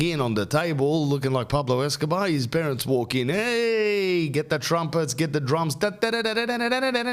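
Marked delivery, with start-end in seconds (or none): 3.3-7.1 high, hammed-up fanfare; gleeful high-pitched build to a fanfare; mock celebration. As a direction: herald the arrival with sung trumpet noises. Mock celebration as parents walk in.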